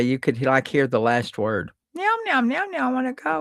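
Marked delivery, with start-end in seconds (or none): 1.9-3.4 high meowing cat voice; talking cat. Retold or imitated. Mimicking the puppet cat that only says meow. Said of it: "that's all it could say".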